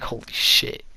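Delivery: a hushed, stunned holy shit; breathless whisper. Retold or imitated. Kid whispering after a parent threatens a classmate, hushed. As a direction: whisper it under your breath in shock.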